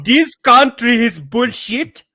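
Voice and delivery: high, loud, slow foreign accent; mock immigrant Minnesotan accent. Shown as a demonstration. Podcaster joking Minnesota has changed, loud foreign accent. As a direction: declaim it slowly in a thick foreign accent. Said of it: "I'll do a Minnesotan accent".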